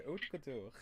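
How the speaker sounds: "haute couture" with a French r at the end; French pronunciation. Shown as a demonstration. Teacher amused at giving the French way, French pronunciation. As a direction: say "haute couture" as "oat koo-TOOR", French-style. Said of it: "I'm telling you the French way".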